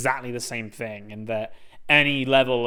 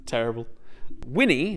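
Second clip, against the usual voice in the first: normal talking voice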